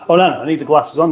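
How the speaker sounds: normal talking voice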